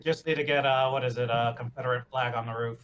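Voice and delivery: ordinary talk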